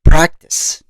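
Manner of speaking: Practice said alone, punched and set apart like bold uppercase; stress on Practice. Shown as a demonstration. To viewers, hammering home the key word, emphatic stress. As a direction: land hard on Practice as the operative word. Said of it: "Practice being the operative word".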